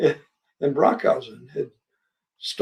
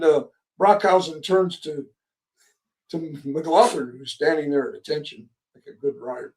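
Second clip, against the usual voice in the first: ordinary delivery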